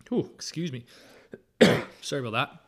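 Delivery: coughing, choking on spit; strained voice while choking, coughing. Real, not acted. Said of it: "Choking on my own spit".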